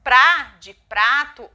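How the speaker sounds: slow and loud, prá set apart from prato; careful example syllable. Shown as a demonstration. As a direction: hit prá clearly, slowly, then prato. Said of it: "uma sílaba como". (sílaba prá destacada, devagar e forte)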